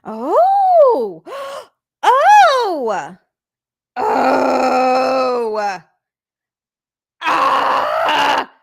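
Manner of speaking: overjoyed cries of surprise; excited shrieks. Real, not acted. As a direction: squeal with overwhelmed joy. Overwhelmed seeing donation pictures.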